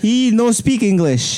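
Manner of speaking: slow, high mock broken English. Teasing the podcast crew in mock broken English. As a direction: ham up a wrestler's broken English.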